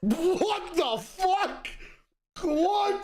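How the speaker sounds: stunned disbelief. Real, not acted. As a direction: stretch it out in total disbelief. Stunned by the JFK answer.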